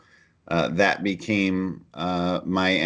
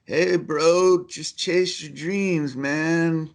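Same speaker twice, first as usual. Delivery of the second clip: high-pitched, drawn-out hippie voice; hammed-up hippie dude, stretching the words; hippie guy; 9 semitones higher, much slower than usual. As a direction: put on a mock stoner-hippie voice, pitched way up. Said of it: "some hippie guy was like".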